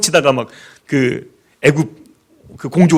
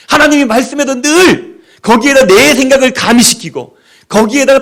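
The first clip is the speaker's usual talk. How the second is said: higher, louder preaching; fiery preaching; 7 semitones higher, 4 dB louder than usual. Acted. Preacher scolding the congregation, higher and louder. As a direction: rebuke human nature, pressing hard.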